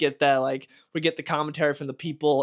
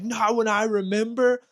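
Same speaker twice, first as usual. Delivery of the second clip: higher, slow, grand heroic delivery; dramatic hero line; 7 semitones higher than usual. Retold or imitated. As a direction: give Superman's defiant line big and slow. Rereads Superman's big line dramatically.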